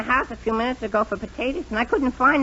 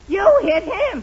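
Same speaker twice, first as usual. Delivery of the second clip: accusing; 5 semitones higher, 5 dB louder, much slower than usual. Acted. Boy accuses husband.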